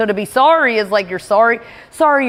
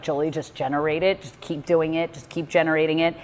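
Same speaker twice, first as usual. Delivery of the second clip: a lower, softer pep talk to herself; quiet self-talk; 4 semitones lower, 8 dB quieter than usual. Retold or imitated. Coaching herself through the fight with her mother, lower and softer. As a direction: murmur encouragement to yourself. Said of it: "But then I was like".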